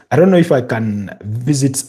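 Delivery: normal talking voice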